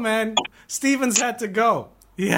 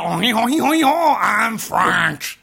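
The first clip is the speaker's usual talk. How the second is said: mock French accent; 3 semitones higher than usual. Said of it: "when he tries to sound French".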